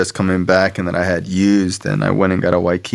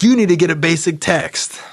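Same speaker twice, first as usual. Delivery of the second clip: high, put-on ordering voice; bossy woman; 9 semitones higher than usual. Woman telling newcomer to get the book, bossy. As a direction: imitate a woman laying down the law.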